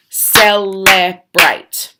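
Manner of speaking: teacher dragging out celebrate; slow, loud model word. Shown as a demonstration. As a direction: celebrate stretched out slowly with force. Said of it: "Three syllables".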